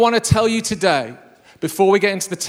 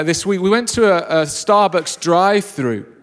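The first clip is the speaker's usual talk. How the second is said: normal talking voice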